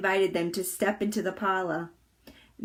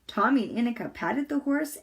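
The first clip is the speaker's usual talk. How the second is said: ordinary delivery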